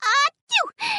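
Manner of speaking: sneeze. Acted. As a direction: sneeze from the cold. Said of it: "Miss Grasshopper was so cold".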